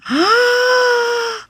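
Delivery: big high gasp; delighted gasp. Real, not acted. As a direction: gasp at the reveal. Reveal of the sunglasses.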